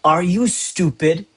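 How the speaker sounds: deliberate, stressed "stupid" as an example; emphatic question. Shown as a demonstration. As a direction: stretch and punch "Are you stupid". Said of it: "putting emphasis on the particular word".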